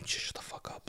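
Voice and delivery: sharp unvoiced whisper; whispered hush. Stressed-out dad to a baby at night, whispering. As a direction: whisper it so nobody else wakes.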